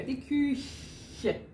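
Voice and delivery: Die Küche said softly with a smile, mouth open; smiling open mouth. Shown as a demonstration. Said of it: "Pensem em sorrir".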